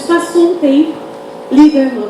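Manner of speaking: ordinary talk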